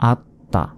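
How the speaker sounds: আত্মা said as at-ta, the t held; doubled consonant. Shown as a demonstration. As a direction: hold the t, then release it into ta in আত্মা. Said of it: "দুইবার উচ্চারণ করলাম". (আত্মা-তে ত দুইবার, আত-তা)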